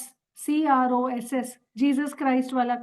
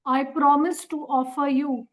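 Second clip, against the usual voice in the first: slow dictation. Shown as a demonstration.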